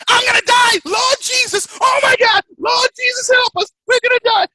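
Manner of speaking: very high, loud, shrieking mock prayer; panicked woman. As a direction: shriek like a terrified woman begging the Lord. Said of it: "the woman two seats back".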